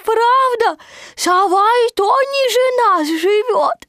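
high, loud squealing little voice; excited Roo. A narrator's reading. Roo boasts from up the tree, excited shouting. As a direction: call down gleefully, high and drawn out. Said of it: "кричал Ру".

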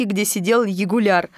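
usual speaking voice

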